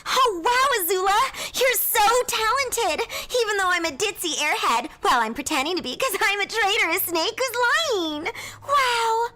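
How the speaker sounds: very high, gushing airhead squeal; Ty Lee impression. Sister imitates her ditzy best friend, high squealing gush. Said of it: "here's my Ty Lee".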